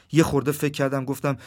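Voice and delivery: plain reading voice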